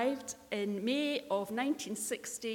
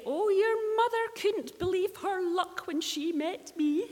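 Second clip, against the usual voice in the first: high, loud teasing boast; cheekily boasting as Dad; cheeky dad voice; 9 semitones higher, 6 dB louder than usual. Retold or imitated. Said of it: "Alec used to cheekily say".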